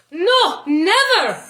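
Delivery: loud, high refusal; shout. A narrator's reading. Angelo shouting his refusal at Tony. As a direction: cry out against it. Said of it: "Angelo shouted".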